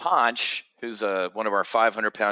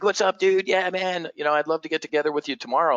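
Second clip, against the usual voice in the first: higher-pitched, breezy imitation of a friend; chummy, eager phone voice; upbeat buddy greeting; 7 semitones higher, faster than usual. New friend agreeing to meet tomorrow, upbeat and chummy.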